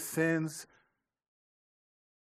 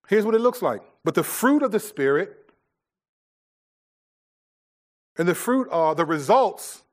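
loud, raised preaching of scripture; 5 semitones higher, 6 dB louder than usual. Acted. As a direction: proclaim it to the congregation. Reads scripture with force.

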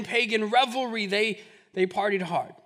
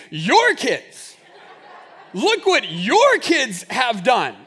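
higher, louder, slowed accusing wife voice; annoyed wife; 4 semitones higher, 6 dB louder than usual. Retold or imitated. Wife Angel blaming [name] for the kids, mock accusing. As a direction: blame him for the kids, laughing. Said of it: "It's always".

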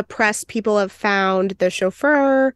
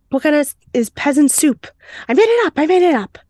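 hammed-up panic; 7 semitones higher, 3 dB louder, faster than usual. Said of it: "And he's like".

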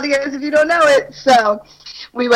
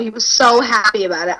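ordinary talk

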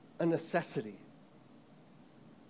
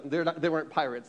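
usual speaking voice

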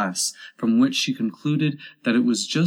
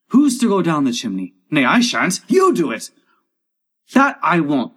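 high, loud workmen's squabble; raised, bickering crew voices; arguing loudly; 5 semitones higher, 6 dB louder than usual. Acted. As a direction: refuse and pass the job on. Crew argue over the chimney.

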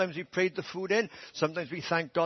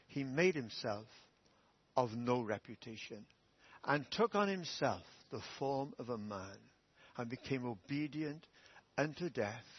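scripture reading; 4 semitones lower, 4 dB quieter than usual. Acted. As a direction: read it reverently and quietly. Reads scripture quote.

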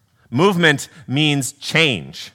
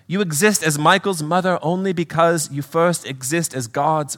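earnest British spirit, slightly raised; British accent; 4 semitones higher than usual. Acted. Brother correcting a grieving mother, earnest British accent. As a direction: reason with her in a British accent. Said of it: "do a little accent work".

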